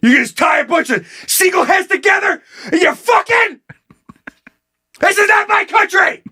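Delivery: high-pitched, shouted mock outrage; outraged rant. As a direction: shout it like an outraged citizen, hammed up. Acting outraged man's rant.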